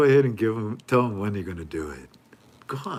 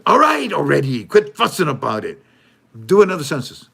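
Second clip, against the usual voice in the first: lightly louder, higher impatient politician; mock exasperated politician; 5 semitones higher, 8 dB louder than usual. The politician caving to critics, a mock exasperated loud reply. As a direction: brush off the fuss like a fed-up politician. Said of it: "one of them said".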